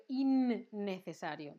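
"innecesario" with a held, doubled n; double N. Shown as a demonstration. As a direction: linger on the nn of "innecesario". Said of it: "dos Ns".

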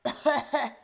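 small faked cough; fake cough. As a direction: cough as if sick. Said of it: "Tu dis que tu es malade".

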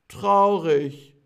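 mournfully drawn out for the class; sad. Shown as a demonstration. Teacher shows the word for sad, sadly. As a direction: say it with a sad, drooping voice. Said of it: "als nächstes haben wir traurig".

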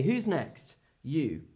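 usual speaking voice

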